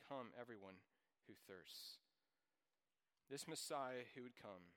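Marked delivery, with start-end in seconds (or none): 0.1-2.0 much softer and lower, almost to himself; soft echo. Acted. Preacher repeating the invitation to the congregation, soft and low. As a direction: let the verse settle in a hushed voice.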